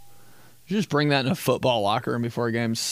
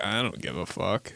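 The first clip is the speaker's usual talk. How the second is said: low, soft flat-toned mock sister; bored sisters; 5 semitones lower, 7 dB quieter than usual. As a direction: mumble it like a bored teen. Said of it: "My sisters would be like".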